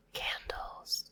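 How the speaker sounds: whispered echo of "candles". Shown as a demonstration. Teacher whispering the word back for kids. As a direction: breathe "candles" softly with no voice.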